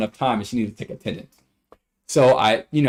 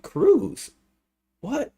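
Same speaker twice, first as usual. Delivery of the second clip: confused student; 12 semitones higher than usual. Retold or imitated.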